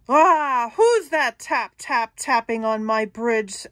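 very loud troll roar; typhoon troll. Acted. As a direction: blast it like a storm. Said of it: "That voice again, only worse, like a typhoon".

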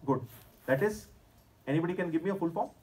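normal talking voice

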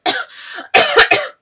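loud harsh coughing fit; hard cough. Real, not acted. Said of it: "I'm coughing so much".

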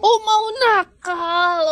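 playfully menacing, slow; mock threat. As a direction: stretch it slowly like a playful warning. Parent teases child with mock threat.

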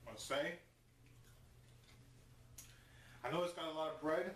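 speaking between bites; talking while chewing. Real, not acted. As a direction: mouth partly full. Seen 0: Bites burrito just before, mouth working while looking down.

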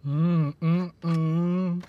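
humming while chewing; muffled closed-mouth hum; mouth full chewing. Real, not acted. Seen 0: Cheeks puffed, lips closed, chewing after biting bun at -2.

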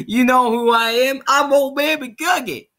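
high, loud, cracked old-timer impression; excitable Southern old man voice; Old Man McGuggin. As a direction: holler it like a crazy old coot. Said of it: "Old Man McGuggin".

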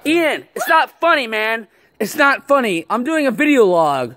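high, agitated telling-off; exasperated, high-pitched complaint; upset scolding. Real, not acted. Scolds friend after second scare.

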